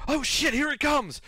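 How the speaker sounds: high, loud alarmed yelp of words; kobold calling out. Acted. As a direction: shout a panicked warning as a little creature. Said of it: "call out".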